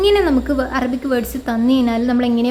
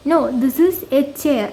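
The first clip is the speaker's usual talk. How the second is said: slow model answer; much slower than usual. Shown as a demonstration. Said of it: "അപ്പോൾ answer പറയണം".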